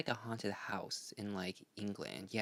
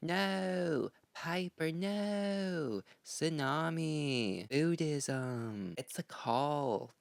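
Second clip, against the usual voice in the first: higher, drawn-out Southern drawl; Parker Posey; 4 semitones higher, much slower than usual. Acted. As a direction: stretch every word in a theatrical Southern accent. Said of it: "let's all take a moment to say her famous line".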